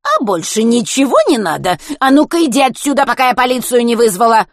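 put-on loud, high old woman's tirade; angry scolding. A narrator's reading. Old woman threatening a boy with police, irate. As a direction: chase the kid off at the top of your voice.

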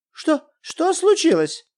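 high, alarmed wife's question; испуганный вопрос; frightened. Acted. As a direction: rush in scared. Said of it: "испуганная Елена Николаевна".

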